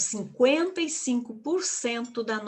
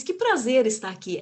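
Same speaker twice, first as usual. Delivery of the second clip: lively, with a lift on "prazer"; energetic sincere welcome; 2 semitones higher, 4 dB louder than usual. Shown as a demonstration. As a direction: greet the audience as if truly delighted. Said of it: "quando eu comecei dizendo pra vocês". (com energia, prazer de verdade)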